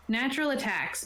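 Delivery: ordinary delivery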